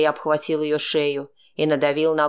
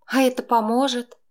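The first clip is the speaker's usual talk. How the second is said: higher wry female voice; rueful girlfriend voice; 9 semitones higher than usual. A narrator's reading. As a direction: ask it with a rueful edge, higher. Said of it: "С невесёлым смешком отозвалась она".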